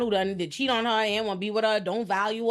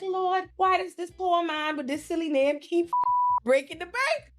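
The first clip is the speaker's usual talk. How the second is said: higher, slow, put-on voice of disbelief; baffled inner voice; 6 semitones higher than usual. Puzzling over the date who keeps paying, slowly. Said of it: "I'm like".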